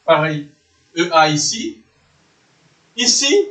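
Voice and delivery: usual speaking voice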